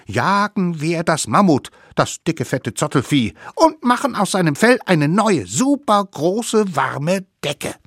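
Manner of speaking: high, loud excited little savages; rallying cry of tiny hunters; eager hunters' cheer. A narrator's reading. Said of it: "riefen, stapften entschlossen los".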